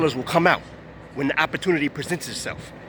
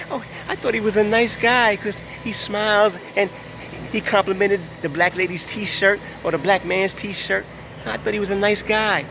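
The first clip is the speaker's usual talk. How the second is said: high, gushing mock admirer voice; mocking naive fan; 4 semitones higher than usual. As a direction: parody a gullible fan gushing.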